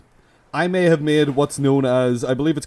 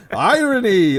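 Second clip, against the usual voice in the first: higher, louder mock-triumphant cry; hammed-up genie; 7 semitones higher, 5 dB louder, slower than usual. Host crowing about his twist, hammed-up genie. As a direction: crow the word like a gloating djinn.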